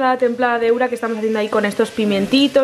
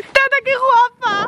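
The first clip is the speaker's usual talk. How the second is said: high, slow, gushing greeting; affectionate; 14 semitones higher, 4 dB louder, slower than usual. Real, not acted. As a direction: gush over her. (saludo cariñoso y agudo)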